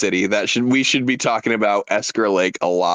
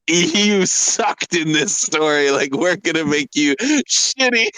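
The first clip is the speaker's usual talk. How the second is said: mocking; 6 semitones higher, slower than usual. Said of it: "be like".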